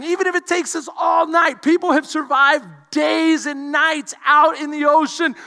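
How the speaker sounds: loud, pumped-up preacher voice; rally him like a coach; preaching. Acted. Pastor replaying how he cheered on his brother, loud. Preacher reenacting encouragement loudly.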